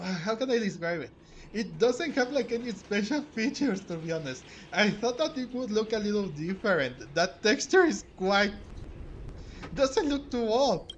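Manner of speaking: animated. Real, not acted. Streamer doubts the rock fragment.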